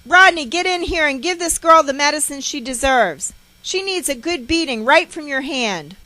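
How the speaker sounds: high, loud summons; shouted, outraged demand; angry calling out. A narrator's reading. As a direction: holler for your husband in a fury. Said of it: "she is calling for my father".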